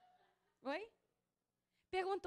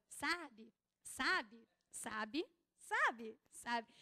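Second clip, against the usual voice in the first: repeated verbal tic; 6 semitones lower, much slower than usual. Retold or imitated. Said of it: "eu reparei que toda hora eu, eu falava".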